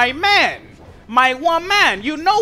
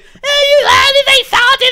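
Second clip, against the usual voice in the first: shrill, much higher, louder mom yelling back; shouted mama impression; 9 dB louder than usual. Son mimicking mom insisting the spaghetti isn't salty, high shouted voice. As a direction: shout it like an outraged mother denying it. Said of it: "she always like to argue me".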